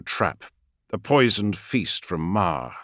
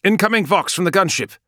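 high, loud pilot voice; a crisp pilot calling in from the cockpit; gunship pilot; 12 semitones higher, 6 dB louder than usual. Acted. Pilot announces the vox.

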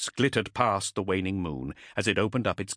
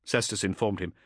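usual speaking voice

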